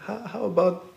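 high, hesitant little voice; acted-out timid suggestion, halting and high; shy inner voice. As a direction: pipe up timidly, pitched well above normal. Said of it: "to the shyest voice".

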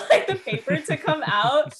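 laughing through the words, pitch up; giggling as she describes it; amused laugh breaking into the line. Real, not acted. Laughing at tense fax scene.

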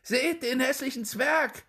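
high, loud mocking shouts from the street; jeering crowd calls. A narrator's reading. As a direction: call out like gawking townsfolk. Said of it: "Überall hörte er rufen".

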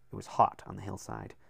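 ordinary delivery

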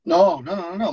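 a hint of a busy New York agent; agent on the phone. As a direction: brush him off mid-call. S0 reads George.

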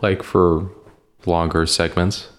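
normal talking voice

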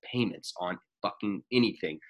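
ordinary talk, nothing marked

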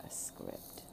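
slow, breathy whisper of a secret truth. Real, not acted. Speaker confiding the core idea to listeners, whispered slowly. Whispered key point in talk.

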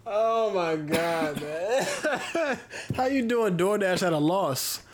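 high laughing oh my God, slow and stunned; cracking up in disbelief at a money-losing plan. Real, not acted. Friend laughing at a DoorDash driver losing money, laughing disbelief.

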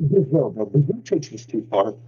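muffled, like talking into a fan; inside a pillow. Real, not acted. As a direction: voice smothered as if through a pillow. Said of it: "you sound like you're inside a pillow right now".

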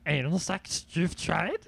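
lightly voiced Yoda; a hint of Yoda, backwards words; Yoda impression. Joking as Yoda to Obi-Wan, lightly voiced. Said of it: "Hey, Obi-Wan".

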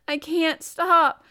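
high, loud, drawn-out wail; gasped sob. A narrator's reading. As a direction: wail it helplessly, close to sobbing. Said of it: "he gasped and sobbed".

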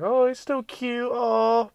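very high, slow cooing mock voice; doting dog owners. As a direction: gush over the dog in a squeaky falsetto. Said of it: "everyone's like".

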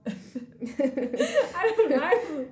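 breaking into a real laugh, slowed right down; embarrassed laugh while getting emotional. Real, not acted. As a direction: laugh through the words, caught off guard. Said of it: "put me down a road of getting emotional".

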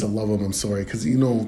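usual speaking voice

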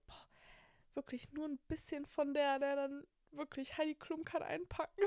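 high and loud admiring gush. Retold or imitated. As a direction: rave about a stranger's looks. Gushing about pretty girl on train. (begeistert schwärmend)

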